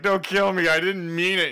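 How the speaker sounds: high, loud mock pleading; hammed-up whine, begging for life; mock crying. Retelling an accused man begging for his life, mock crying. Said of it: "starts crying like a little bitch".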